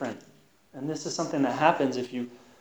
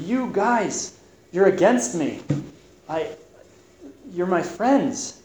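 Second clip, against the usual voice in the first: higher-pitched, emphatic appeal to friends; hurt protest; 6 semitones higher, slower than usual. Acted. Job confronting his friends who turned against him, in hurt protest.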